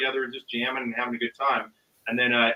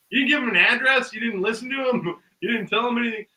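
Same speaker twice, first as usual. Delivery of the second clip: higher-pitched baffled questioning; incredulous; 6 semitones higher than usual. Retold or imitated. Storyteller replays questioning the man who hung up, incredulous. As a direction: re-enact disbelief, half amused.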